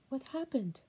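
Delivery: anguished grandmother repeating her question. Acted. Grandma pleads about the children.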